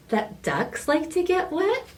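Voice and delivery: high, sly question; coy question. Acted. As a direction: ask it sweetly, all innocent.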